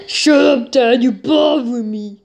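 mimicking the clip. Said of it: "I love the final scene".

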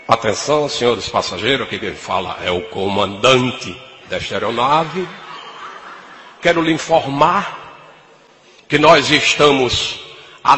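low, slow pilot announcement voice; pilot cockpit headset. Retold or imitated. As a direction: cabin speaker drone, deep and mumbled. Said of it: "Aí ele fica com moído". (voz de comandante no alto-falante)

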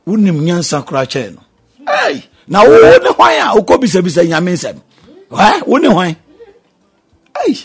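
loud, high scolding exclamations; indignant rebuke. Acted. Preacher scolding those who question God, loud rebuke. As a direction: rebuke them with mounting outrage.

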